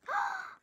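high, sharp gasp; high-pitched intake of breath; thrilled gasp. Real, not acted. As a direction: gasp at a lucky pull. Reveals member cards.

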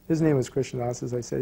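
normal talking voice

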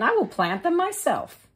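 high, bright hen voice; little red hen. Acted. The hen asking neighbours about seeds, in a high voice.

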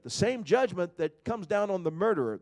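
ordinary talk, nothing marked

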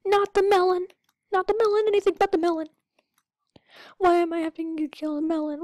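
high, loud mock plea; mock pleading. Gamer begging the game to spare the melon, high loud mock plea. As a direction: beg dramatically to spare it.

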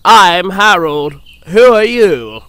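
loud, slow, drawn-out introduction; self-important helicopter. A narrator's reading. Harold introduces himself to Percy, self-important. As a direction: announce yourself grandly, stretching each word.

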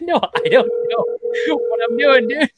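high, loud, laughing denial; laughing protest. Real, not acted. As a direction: laugh off the praise. Laughs off praise of Spanish.

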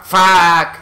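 loud, drawn-out swear; exploding curse. Real, not acted. Curse bursts out when hit.

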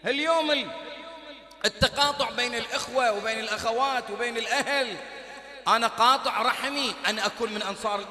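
loud, driving sermon cadence; fiery preaching. Acted. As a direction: pound each condition like a verdict. Preacher exhorting at full force.

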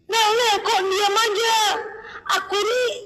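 mock distressed complaint; complaining friend. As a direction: imitate a friend griping for attention. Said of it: "banyak kali nih keluhannya".

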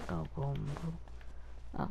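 normal talking voice

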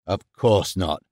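low-pitched, restrained indignant denial. A narrator's reading. Norman rejecting Holmes's accusation, low indignant reply. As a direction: deny it indignantly without raising your voice. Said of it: "replied indignantly".

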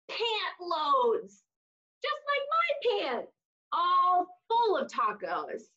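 lively storybook voice for little listeners; reading to children. Acted. Teacher reading a taco story to kids, animated storybook voice. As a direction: read "hola" book style, animated and bright. Reads picture book to children.

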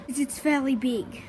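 ordinary talk

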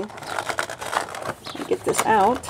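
crinkling box while talking; crunching packaging. Real, not acted. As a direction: talk over the crunch of packaging. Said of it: "sorry for the crunching".

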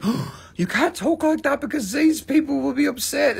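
high put-on whiny scold; mocking scolder. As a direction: lampoon the people policing speech.

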